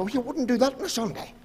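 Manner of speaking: high mocking echo of the old uncle; lightly voiced uncle, half-teasing. Preacher echoing his uncle's Sunday warning, mock high voice.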